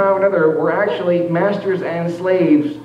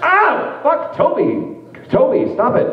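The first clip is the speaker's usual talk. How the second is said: much higher hammed-up yelps; mock pained cry; 11 semitones higher, slower than usual. Roleplayer crying out under the whip, hammed-up and high. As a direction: ham up the pain, pitched high.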